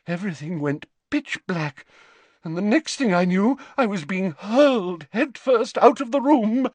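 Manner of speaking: high, theatrical seer in outrage; Professor Trelawney, indignant. A narrator's reading.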